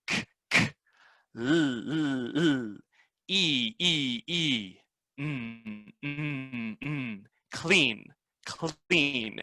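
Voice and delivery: whispered "k", then "l", "E", hummed "mm", then "clean"; sounding out clean. Shown as a demonstration. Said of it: "the way I do it is".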